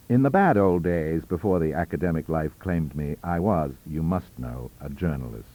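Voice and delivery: plain reading voice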